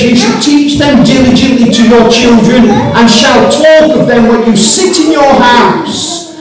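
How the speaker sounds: quoted verse lifted high and strong; preached scripture. Shown as a demonstration. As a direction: proclaim the verse to the church. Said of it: "it says".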